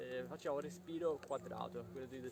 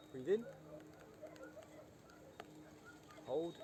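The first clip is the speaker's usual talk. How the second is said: spaced-out breathing commands, hold stressed; guided breathing cues; 3 semitones higher, 4 dB quieter than usual. Shown as a demonstration. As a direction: count the breath cycle for the class.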